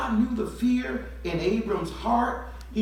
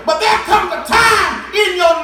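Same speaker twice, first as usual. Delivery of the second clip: much higher and louder, shouting; full preaching shout; 9 semitones higher, 11 dB louder than usual. Real, not acted. Preacher shouting to the church about hearing God. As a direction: hit the climax hard.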